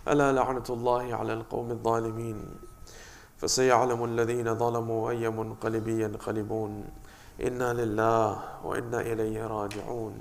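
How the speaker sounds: fast, even liturgical chant; rapid Arabic recitation. Acted. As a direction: rattle off the closing formulas quickly.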